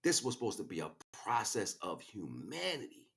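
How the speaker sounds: ordinary delivery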